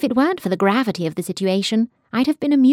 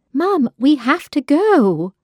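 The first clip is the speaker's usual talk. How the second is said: higher, louder and slow, pressing hard; urgent plea; 7 semitones higher, 5 dB louder, slower than usual. Acted. Daughter begging her mother to flee the bombs, raised urgent plea. Daughter pleading during bombing.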